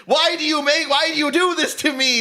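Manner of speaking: high, loud mock-anguished wail; mock scream. Writer wailing at his audience, hammed-up high loud cry. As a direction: ham up the tortured writer's cry. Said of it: "screams the writer".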